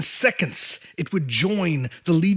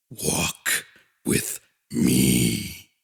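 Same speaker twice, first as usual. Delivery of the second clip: colossal god voice; 9 semitones lower, much slower than usual. A narrator's reading. Overmind speaks to abomination.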